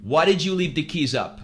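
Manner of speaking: put-on Greek accent. Greek scooter renter questions his customer, in a Greek accent. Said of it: "an old Greek man".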